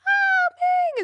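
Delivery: very high squealing fangirl gush; tween groupie voice. Acted. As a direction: swoon over him like a starstruck preteen.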